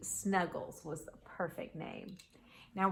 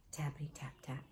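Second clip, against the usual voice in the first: whispered tap-tap sound; hushed knocking; 5 semitones lower, 13 dB quieter, faster than usual. A narrator's reading. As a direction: whisper it very softly, like faint knocking. Imitating soft knocking in story.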